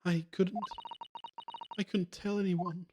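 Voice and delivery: put-on high, slow young girl's voice. Game character confessing she told no one, young girl voice. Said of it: "I keep putting the young girl voice on her".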